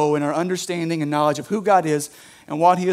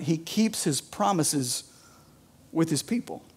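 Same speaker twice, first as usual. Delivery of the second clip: quiet; 8 dB quieter than usual. Shown as a demonstration. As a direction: say it softly, letting it sink in. Repeats his point softly.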